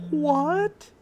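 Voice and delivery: loud shocked what; mock shocked outburst; surprised. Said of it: "Ash is gonna be like".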